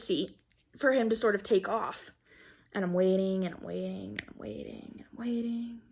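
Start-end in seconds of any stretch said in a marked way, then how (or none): 2.7-5.8 trailing off softer with each repeat; dragged-out waiting. Retold or imitated. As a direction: fade toward a near-whisper, as if time drags on. Repeats waiting, fading away.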